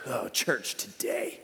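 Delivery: low, slow disgruntled grumble; mock-sour complaint over coffee; grumpy church guy. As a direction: grumble it like an annoyed old-timer. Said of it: "he was just angry about something".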